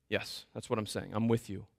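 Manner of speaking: gentle reassurance. Acted. God answering Moses gently.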